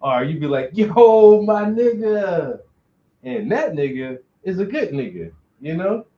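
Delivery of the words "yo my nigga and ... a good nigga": much louder, higher, stretched-out happy greeting; friendly greeting. Shown as a demonstration. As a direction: call out to your best friend, slow and glad. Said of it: "the tone in your voice and the inflection lets you know".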